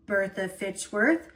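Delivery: ordinary delivery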